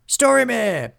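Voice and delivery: high, loud cry of recognition; joint cry. A narrator's reading. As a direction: cry out the name, high and drawn out. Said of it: "cried the friends".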